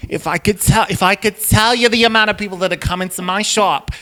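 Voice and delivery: chatty Bronx shopkeeper with a theatrical gasp; Bronx Medusa. Acted. Medusa from the Bronx bragging about shop customers, lightly hammed-up. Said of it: "I'm gonna do Medusa, but she's from, like, the Bronx".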